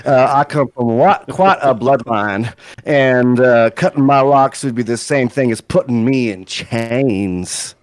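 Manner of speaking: affronted Southern belle drama; aghast Southern belle. Acted. Proud purple-haired man refuses a haircut, aghast as a Southern belle. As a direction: take grand offence like a Southern belle. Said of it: "he's, like, aghast as if a, uh, you know, like, a Southern belle".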